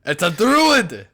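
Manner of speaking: loud, high, drawn-out cry; shouted revelation. Acted. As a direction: shout it out slowly, pitch leaping up. Alarmed cry at the tree.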